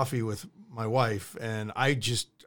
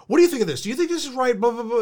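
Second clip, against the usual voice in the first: eager rambling; 8 semitones higher, faster than usual. Retold or imitated. Mocking his own morning rambling.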